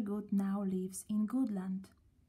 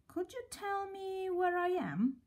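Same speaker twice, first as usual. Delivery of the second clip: high, hopeful question to a stranger; lost and asking; 9 semitones higher than usual. A narrator's reading. Mister Good asking the kind woman where he is, higher and hopeful. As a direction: ask gently, higher, lost. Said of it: "he asked".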